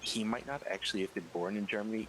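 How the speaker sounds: ordinary talk, nothing marked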